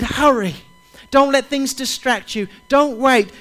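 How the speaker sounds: loud, urgent pleading; urgent call. Acted. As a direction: urge him not to wait, full voice. Preacher voices Jesus urging Zacchaeus.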